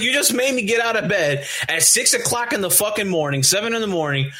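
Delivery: high, indignant complaint, acted out; retold annoyance. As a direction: replay griping at being dragged out of bed. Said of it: "I'm already pissed off now".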